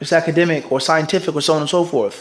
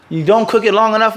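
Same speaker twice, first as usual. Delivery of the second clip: normal talking voice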